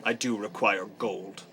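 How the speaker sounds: weird serious character. Acted. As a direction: state terms gravely, with long pauses. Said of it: "weird serious".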